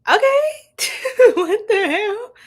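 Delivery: high, loud laughing outburst; high-pitched incredulous cackle through the words; laughing disbelief. Real, not acted. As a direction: burst out laughing in startled disbelief. Startled, amused reaction to reading.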